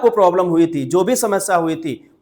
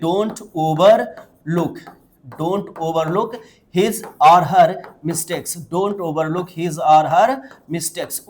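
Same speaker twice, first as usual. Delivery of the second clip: each word drawn out slowly for learners; slow dictation pace; slow model phrase; much slower than usual. Shown as a demonstration. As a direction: spell it out slowly for students to repeat. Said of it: "बोलिए".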